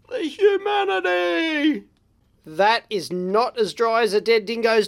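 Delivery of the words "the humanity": high, drawn-out theatrical wail; mock-tragic lament. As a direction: wail it slowly in hammed-up horror. Mock lament over horrific soldering.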